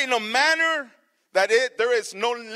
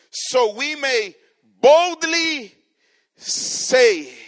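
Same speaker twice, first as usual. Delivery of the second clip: boldly drawn out slow and strong; stress on boldly; 3 semitones higher, 7 dB louder, much slower than usual. Shown as a demonstration. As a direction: lean hard on "boldly" and stretch it. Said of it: "Notice he doesn't say".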